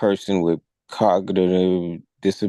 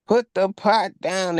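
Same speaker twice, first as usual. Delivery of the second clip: mock yell; 12 semitones higher than usual. Both officers yelling at the woman, high mock yell. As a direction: mimic cops yelling, pitch way up. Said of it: "They still yelling at her".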